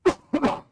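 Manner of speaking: short real cough; quick dry cough. Real, not acted. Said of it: "And a little cough for you".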